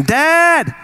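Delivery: put-on high, slow child's wail; screaming kid. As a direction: whine it like a little kid waking dad. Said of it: "call me at four in the morning screaming".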